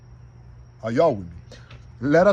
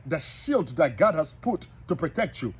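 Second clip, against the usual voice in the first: ordinary delivery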